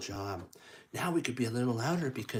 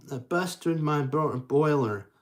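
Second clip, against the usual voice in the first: a hint of a train character, louder; slightly louder, playful engine voice; lightly voiced Thomas; 3 semitones higher, 7 dB louder than usual. Thomas the engine exclaiming, a hint of a character voice. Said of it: "That's what Thomas says".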